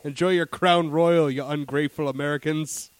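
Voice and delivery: slow Canadian mascot, mock scolding; Monty the Moose. A Canadian moose addresses ungrateful Americans, mock-huffy mascot voice. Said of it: "Monty the Moose up there talking about it".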